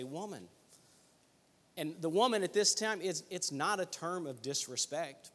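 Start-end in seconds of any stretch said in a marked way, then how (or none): 0.0-0.5 tender. Shown as a demonstration. Jesus addressing his mother from the cross, soft and tender. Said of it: "I think the inflection there is".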